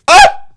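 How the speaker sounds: high, loud shocked shriek then a gasp. Real, not acted. Host reacting to the lip sync result, shrieking gasp. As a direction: gasp like the floor fell out.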